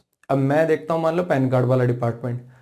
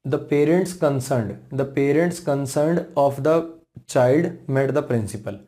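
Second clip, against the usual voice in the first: very slow, word by word; slow dictation; much slower than usual. Shown as a demonstration. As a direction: spell it out slowly for the class. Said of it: "dictates example sentence slowly". (बहुत धीरे, शब्द-शब्द बोलकर)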